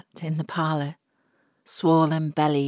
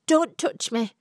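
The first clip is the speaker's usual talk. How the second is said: hissed warning; 7 semitones higher than usual. A narrator's reading. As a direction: spit it through the teeth. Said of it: "she hissed at him".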